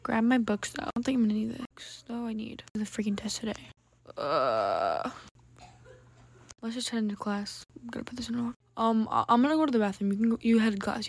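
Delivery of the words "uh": long, low "uh" of dread; low groan. Pretend. Student groaning about the test today, low groan.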